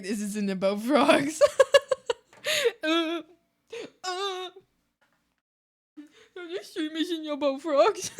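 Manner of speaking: words broken by laughter; laughing fit. Real, not acted. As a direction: try to talk while laughing. Cracks up mid-sentence.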